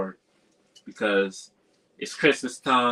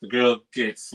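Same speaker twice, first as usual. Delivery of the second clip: normal talking voice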